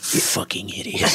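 hushed, slow imagined mutter through a laugh; muttered insult. Retold or imitated. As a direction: breathe the insult under your breath. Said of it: "I know he was just thinking".

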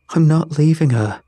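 loud, high, forceful refusal; desperate defiance. A narrator's reading. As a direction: shout it back, refusing to budge. Defiant refusal amid the fire.

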